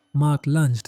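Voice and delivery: ordinary delivery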